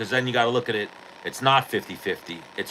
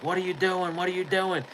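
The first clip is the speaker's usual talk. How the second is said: much higher, alarmed aunt voice; aunt bursting in on a fight, pitched-up alarm; 10 semitones higher than usual. Retold or imitated. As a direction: imitate the aunt rushing in to stop it. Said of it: "my aunt comes running over and is like".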